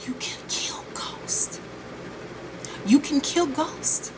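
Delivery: very slow, hushed manipulation; mock-sinister hypnotic whisper; whispered urging. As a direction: whisper it slowly like planting an idea. Acted whispered urging to kill.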